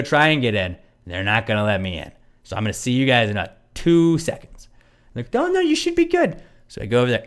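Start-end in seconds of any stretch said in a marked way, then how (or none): 5.4-6.4 high mock voice. Mocking the staff, high voice.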